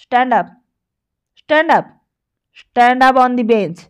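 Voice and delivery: rising shout, slowing hard on the last command; teacher's stern shouted order, building. Acted. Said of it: "চিৎকার করে বলেন".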